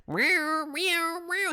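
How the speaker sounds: high, drawn-out playful meows; cat meow. Pretend. Playful cat noises between greetings.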